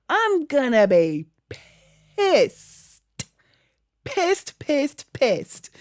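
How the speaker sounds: rising to a high, loud, exasperated yell; hammed-up outrage, punching each pissed; mock fury. Gamer ranting at her chat, loud mock fury. Dreading finding skulls too late.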